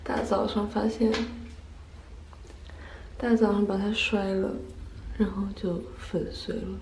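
soft, deflated sigh of disappointment; heartbroken. Real, not acted. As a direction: mourn the broken compact quietly. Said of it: "我真的好伤心".

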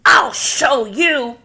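loud, very slow, swaggering vow; puffed-up threat. Acted. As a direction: puff up and stomp away. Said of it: "it puffed itself".

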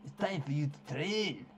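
mock Scottish with a throaty "Ach"; amateur Scottish, hammed up; put-on Scottish accent. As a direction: read it in a bad Scottish accent. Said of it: "Why can't he be Irish?".

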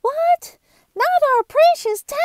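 very high, fast shocked squeal; shocked school teacher. Acted. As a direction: shriek in prim alarm. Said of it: "said the school teacher".

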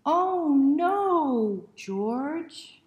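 high loud dramatic cry; loud exclamation. Shown as a demonstration. Reader leading children in the big refrain, loud and high. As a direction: belt it out with mock dismay.

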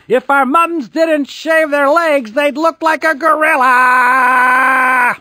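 very high, loud taunting shout; yelled. A narrator's reading. Boys getting revenge on their mums, loud yell. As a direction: shout it across the mall. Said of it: "and yelled".

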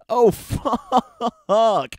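high, loud burst of laughing disbelief; shocked laugh. Real, not acted.

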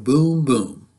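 plain reading voice

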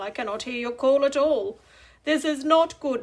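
usual speaking voice

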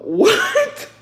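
high, loud laughing "what"; incredulous. Real, not acted. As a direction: burst out in amused disbelief. Baffled by a viewer's odd story.

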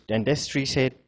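ordinary talk, nothing marked

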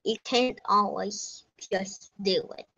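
ordinary talk

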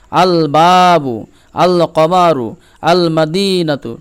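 "আল বাবু, আল কমারু, আল মাদিনাতু" with every l sounded in full; slow recitation, long vowels drawn out in "বাবু", "কমারু", "মাদিনাতু"; lam fully pronounced. Shown as a demonstration. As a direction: hold a clear l in each "আল". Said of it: "লামের উচ্চারণ পূর্ণভাবে হচ্ছে".

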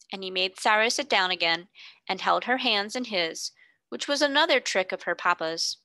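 plain reading voice

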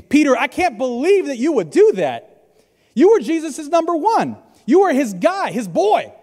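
high, scolding voice of shame; accusing enemy. Acted. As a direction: berate Peter with pointed, incredulous accusations. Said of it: "He wants to push you ... into the shame".

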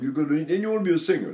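usual speaking voice

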